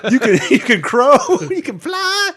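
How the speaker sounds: high, loud, laughing declaration; hammed-up movie cheer. Player quoting Hook to tease the guesser, excited high shouts. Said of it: "You're about to be like".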